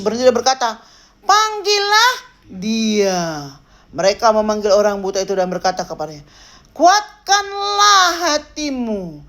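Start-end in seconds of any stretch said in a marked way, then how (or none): 1.2-3.5 very slow, stretched-out command; solemn command. Acted. Acts Jesus' command from scripture. 6.7-9.2 high, long-drawn, almost chanted call; proclaimed encouragement. Acted. Acts crowd's encouraging call from scripture.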